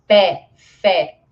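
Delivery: "pé", "fé" punched out with open eh; strong open E. Shown as a demonstration. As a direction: say "pé" and "fé" loudly as PEH, FEH. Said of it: "um E mais forte e aberto".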